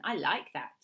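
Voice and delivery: put-on a touch of Italian accent; lightly Italian-accented woman. A narrator's reading. Woman approving the visitor's caution, light Italian accent. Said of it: "she spoke with an Italian accent".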